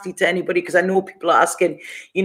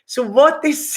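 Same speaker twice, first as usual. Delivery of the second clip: normal talking voice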